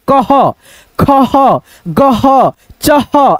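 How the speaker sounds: "कह, खह, गह, चह" each ending in a clear ho; visarga read as h. Shown as a demonstration. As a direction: model "कह, खह, गह, चह" one by one, separated, sounding the final h syllable. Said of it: "विसर्ग को हम लोग ह के रूप में पढ़ते हैं". ("कह, खह, गह, चह" में विसर्ग का ह साफ़)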